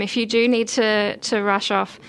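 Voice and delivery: usual speaking voice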